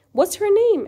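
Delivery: teacher performing John's question aloud; acted question. Shown as a demonstration. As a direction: voice the curious asker brightly.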